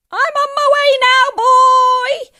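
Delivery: very high, screechy shout; gleeful old woman at full volume; calling down. A narrator's reading. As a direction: holler triumphantly to someone below. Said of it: "she called down to George".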